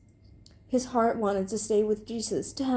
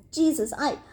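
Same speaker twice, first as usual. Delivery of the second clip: higher, halting nervous protest; flustered stammer, rising louder; stuttering; 6 semitones higher, 5 dB louder than usual. A narrator's reading. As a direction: stumble over it anxiously. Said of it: "Beezus stuttered".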